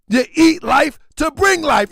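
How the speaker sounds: forceful shouted repeat, stress on bring; emphatic shout. Real, not acted. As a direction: hammer the line home like a preacher. Repeats line, then shouts it.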